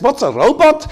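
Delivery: high, loud incredulous echo of the question; the driver can't believe the question; astonished echo. Retold or imitated. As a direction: bounce it back in disbelief.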